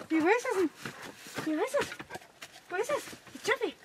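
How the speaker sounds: high sing-song voice for a pet; pet talk. Real, not acted. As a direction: coo to the animal in a high voice. High baby talk to an animal.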